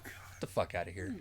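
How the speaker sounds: breathy whispered disbelief; incredulous whisper. Real, not acted. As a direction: hush it in disbelief. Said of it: "disbelief aside".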